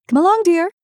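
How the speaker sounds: brisk motherly call. A narrator's reading. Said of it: "replied the mother".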